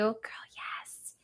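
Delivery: unvoiced whisper. Real, not acted. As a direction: breathe it out. Whispered reply to a comment.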